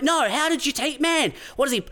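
high, pestering stranger; insistent high-pitched mock exasperation; mock annoyed. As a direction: imitate someone refusing to accept the answer. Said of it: "they'd be, they'd be annoyed".

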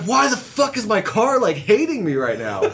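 panicked outburst. Retold or imitated. Retold panic at runaway car.

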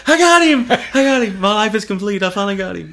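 put-on very high, loud kid cheer, easing off; imitated gleeful triumph. Son celebrating scaring dad, high loud glee. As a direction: crow like a victorious child.

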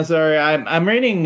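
usual speaking voice

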